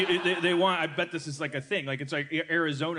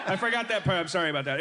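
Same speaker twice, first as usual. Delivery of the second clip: usual speaking voice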